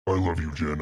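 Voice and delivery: usual speaking voice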